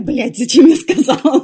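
loud, high panicked self-quote; loud dismay. Retold or imitated. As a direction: yell it at yourself in regret. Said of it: "Я такая".

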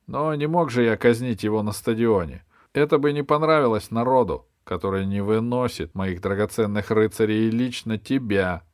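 emphatic monarch justifying himself; self-important king. Acted. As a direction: hold forth as a king who will not be fooled. The king answers the bishop.

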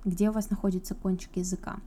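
ordinary talk

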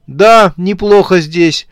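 loud, high, slow phone voice; phone chat. A narrator's reading. Dead man telling someone about his new grave, loud phone chat. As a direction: speak up unhurriedly as if on a call. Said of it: "так, как обычно разговаривают по телефону".

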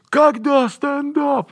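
high, slow whiny call; impatient heckler. Retold or imitated. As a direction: whine it from the crowd. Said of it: "в Чебоксарах было".

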